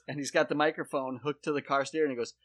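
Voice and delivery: ordinary talk, nothing marked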